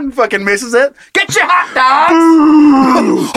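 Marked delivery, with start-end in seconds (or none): 1.1-3.3 very slow high wailing holler; stadium vendor hawking. Pretend. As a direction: wail it while laughing. Vendor crying as surgery fails.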